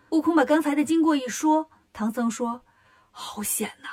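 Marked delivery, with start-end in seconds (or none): 3.1-3.9 very soft, voiceless exclamation; hushed dismay. Acted. Monk hears of his disciple's narrow escape, hushed dismay. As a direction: breathe it out under the voice. Monk reacts to danger told.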